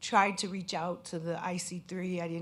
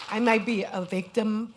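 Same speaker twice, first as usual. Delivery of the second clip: louder, voice straining with tears; emotional; 3 semitones higher, 7 dB louder than usual. Real, not acted. Scam victim steadying herself before the audience, voice straining with tears. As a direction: push through the catch in your throat. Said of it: "I'm getting emotional".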